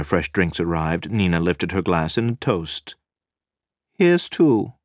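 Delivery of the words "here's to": announcing. A narrator's reading. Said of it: "she announced".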